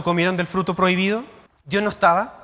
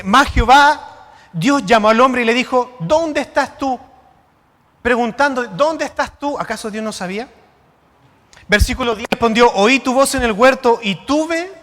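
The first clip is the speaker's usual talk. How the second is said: louder, measured preaching read; scripture reading; 5 dB louder than usual. Acted. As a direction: declaim the verse to the congregation. Preacher reads scripture aloud.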